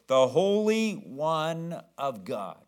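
slow, each word of "The Holy One of God" stretched; drawn-out quote. Retold or imitated. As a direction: draw out "Holy" long and falling. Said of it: "That's what he says".

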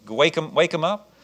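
usual speaking voice

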